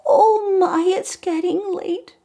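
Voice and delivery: high-pitched fretting pumpkin; worried little pumpkin. Acted. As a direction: squeak it like a tiny pumpkin. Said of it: "the first pumpkin who says".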